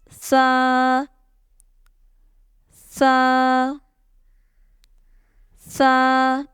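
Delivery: "sa" with the vowel held a little long; slightly stretched sa. Shown as a demonstration. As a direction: draw out each "sa" gently. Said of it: "read it with a slight stretch".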